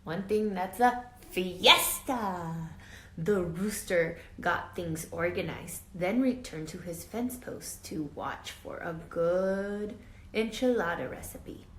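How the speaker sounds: plain reading voice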